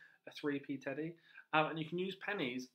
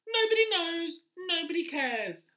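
much higher, much louder dismissive rat; rat character; 10 dB louder than usual. Acted. Teacher voicing the rat dismissing the lizards, loud and high. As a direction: squeal it out scornfully.

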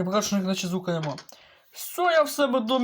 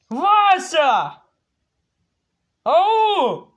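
loud, high yelling at someone; angry calling; 5 semitones higher, 4 dB louder, much slower than usual. Acted. Gamer angrily yelling for villager Vasya. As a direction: bellow to be heard far off.

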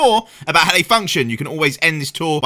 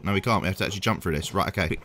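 usual speaking voice

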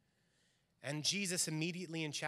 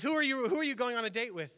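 higher, louder, faster, laughing as you ask; hammed-up jealous husband cracking up; mock jealous outburst; 9 semitones higher, 8 dB louder, faster than usual. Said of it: "he goes".

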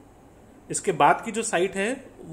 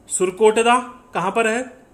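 louder quiz question; 6 semitones higher, 8 dB louder than usual. Real, not acted. Teacher quizzing the class.

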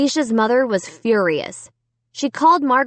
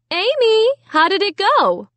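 high, singing-out mom voice; raised, lilting motherly call; calling from the kitchen; 11 semitones higher than usual. A narrator's reading. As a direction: call out to someone in another room. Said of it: "Mom called from the kitchen".

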